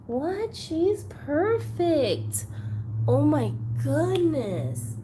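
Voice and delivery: high, drawn-out awe; real amazement. Real, not acted. Collector admiring a gifted doll, high drawn-out amazement. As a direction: stretch the words out high in wonder.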